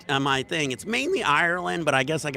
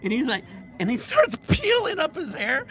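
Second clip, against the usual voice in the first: laughing; 11 semitones higher than usual. Real, not acted. Laughing while telling the toupee story.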